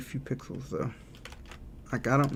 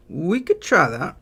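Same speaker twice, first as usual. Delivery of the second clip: higher, slow and louder, stretching each word; drawn-out high take; 5 semitones higher, 6 dB louder, slower than usual. Shown as a demonstration. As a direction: sing it out, lifted and slow. Streamer tries the idea twice.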